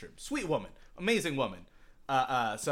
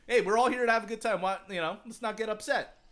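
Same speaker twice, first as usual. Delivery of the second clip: easygoing reassurance; 8 semitones higher, 4 dB louder than usual. Retold or imitated. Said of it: "a lot of people are just like".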